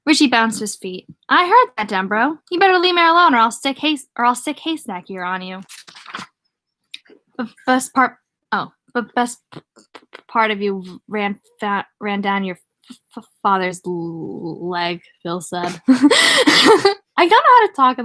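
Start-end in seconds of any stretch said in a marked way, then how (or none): 1.3-5.6 high, loud indignant retort, easing off at the end; hammed-up playful warning; mock threat. Richie's mock threat to Bill. 7.4-15.0 stuck repeatedly on "part", "father's", "leg"; halting, blocked first consonants in "part", "father's", "leg"; stutter. Acted. Reader voices Bill's stutter. 15.9-18.0 high, loud, straight out of a laugh; real amused outburst. Real, not acted. Laughing at own stuttering read.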